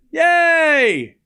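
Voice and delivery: high, loud, held-out cheer; celebratory cheer. Real, not acted. Streamer celebrates winning money on a bet, excited cheer. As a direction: let out a big drawn-out cheer.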